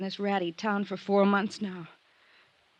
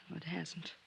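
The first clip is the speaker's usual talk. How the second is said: resigned murmur; 3 semitones lower, 13 dB quieter than usual. Acted. Gloria admits her bad luck.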